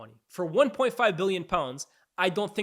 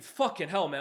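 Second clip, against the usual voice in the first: ordinary talk, nothing marked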